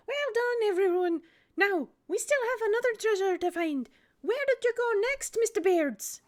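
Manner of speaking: high, bright-pitched dog voice; pug voice. Acted. Pug praises the crew, high proud voice. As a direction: cheer them on as a proud little pug.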